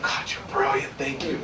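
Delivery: mock-awed breathy whisper; hushed, as if struck by genius. Thanking the collaborator for her idea, whispered in awe. And I went.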